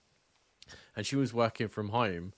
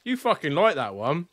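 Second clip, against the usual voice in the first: higher and louder, stung by the spice; loud outcry; 6 semitones higher, 7 dB louder than usual. Real, not acted. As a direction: yell it at your friend, half laughing. Friend served painfully spicy food.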